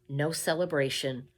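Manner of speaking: plain reading voice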